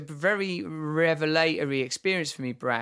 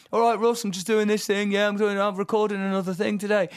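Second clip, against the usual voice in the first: higher, brighter quoted greeting; cheerful Pete; 5 semitones higher, 3 dB louder than usual. Retold or imitated. Friend quoting Pete's breezy daily call, lightly voiced. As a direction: lightly voice a chirpy mate on the phone.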